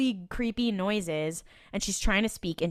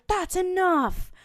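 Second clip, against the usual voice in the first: retold yell; 11 semitones higher, slower than usual. Cop stopping her partner's rough handling, mock yell. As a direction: imitate a stern cutting-in, pitched way up, slow. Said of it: "yells at him and she's like".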